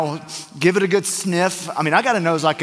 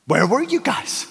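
incredulous; 7 semitones higher than usual. Retold or imitated. Friend's amazed question.

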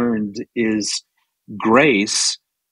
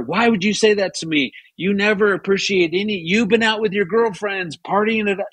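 high, fast accusing outburst; imagined angry retort; 8 semitones higher than usual. Retold or imitated. Husband picturing a fight with his wife: high accusing outburst. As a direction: fire it back, pitched up and quick. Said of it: "had I just gone with my gut".

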